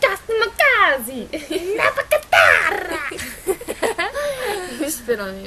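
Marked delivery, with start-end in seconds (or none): 0.0-1.3 high, loud mock Italian shout; Italian accent. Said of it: "Another one is Italian". 1.3-4.8 high shrieking laugh; loud laughter. Real, not acted. Laughing after the accent bit.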